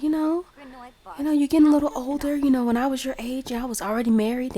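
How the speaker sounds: high, hurried grandma voice; acted nagging grandma.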